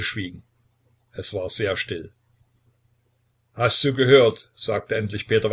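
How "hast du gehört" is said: a stern, louder demand from the father; commanding tsar's challenge. A narrator's reading. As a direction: Peter looming over his son, voice raised. Tsar confronts his son.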